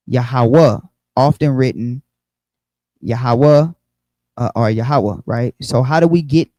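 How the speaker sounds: ordinary talk, nothing marked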